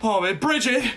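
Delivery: thrilled to see everyone; excited. Real, not acted. Excited greeting of arriving chatters.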